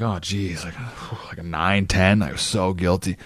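a hammed-up sheepish confession; sighing mock remorse; mock guilty.

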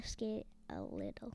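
sly whispered aside. Real, not acted. Son whispering a tease about mom's skating. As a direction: slip in the joke under your breath.

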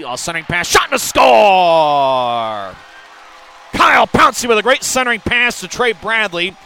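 shouted, high goal call; excited goal call. Real, not acted. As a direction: explode on the score.

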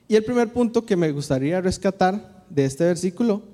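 ordinary delivery

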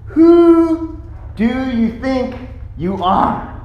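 slow, drawn-out challenge, starting high; deliberate, affronted questioning; indignant challenge. Acted. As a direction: challenge him word by word. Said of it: "the gist of their challenge is".

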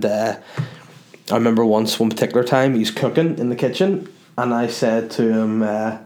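ordinary delivery

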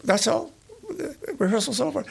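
a half-attempt at the maestro calling time; Stravinsky. Narrator quoting Stravinsky ending rehearsal early, light imitation. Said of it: "I can't do his accent".

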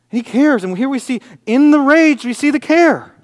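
loud, high pulpit cry; fervent, ringing proclamation to the congregation; impassioned preaching. Acted. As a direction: drive each point home at full voice. Preaches at full force.

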